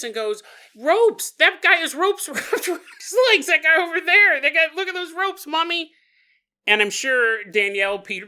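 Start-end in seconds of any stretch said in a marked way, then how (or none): 0.7-5.9 put-on high, loud little kid shouting; alarmed child calling out, pitched way up; imitated excited child. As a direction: point it out to mom in a shrieky kid voice. Small son points and calls out.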